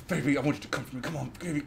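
softly coax, under the breath; hushed pleading between the shouts; mock ASMR coaxing. Acting out ASMR roleplay to the listener, soft coaxing.

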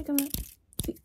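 ordinary delivery

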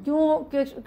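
usual speaking voice

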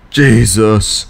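ordinary talk, nothing marked

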